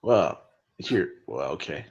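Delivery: ordinary delivery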